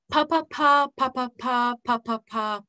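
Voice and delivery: rapid "pa" in bunches of three, then one. Shown as a demonstration. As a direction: rattle off "pa" in triplets, stepping down in pitch. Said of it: "even faster".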